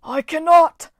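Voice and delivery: high, loud cry of helplessness; wailing. A narrator's reading. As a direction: wail that he can't help it. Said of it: "Lionhead wailed".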